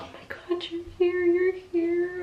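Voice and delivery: very high, squealed re-enactment of joy; overjoyed tearful greeting. Retold or imitated. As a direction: greet a newborn, acting overcome with happiness. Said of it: "I'm crying 'cause I'm so happy".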